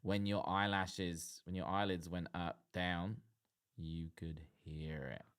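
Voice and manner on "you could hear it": whisper. Real, not acted. Describing total silence.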